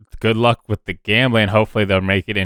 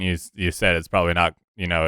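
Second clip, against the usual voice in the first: normal talking voice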